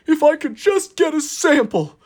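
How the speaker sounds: high strained engine voice, reaching; a silly high little engine straining to reach; Booster's voice. Acted.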